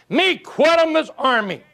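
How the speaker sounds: high, slow, broken-English chief voice; hammed-up chief declaring he quits; Indian chief. Indian chief telling the army he quits, high and slow. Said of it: "he says".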